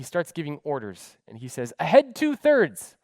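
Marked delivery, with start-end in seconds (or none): 1.8-3.0 loud, slow command; barked order. Retold or imitated. Captain ordering his crew, loud and slow.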